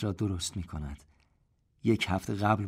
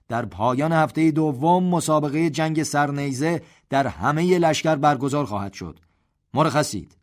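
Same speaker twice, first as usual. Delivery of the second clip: loud, higher voice of command; general's orders; 6 semitones higher, 7 dB louder than usual. A narrator's reading. General gives final orders to the assembly, loud and commanding. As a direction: address the division and dismiss them.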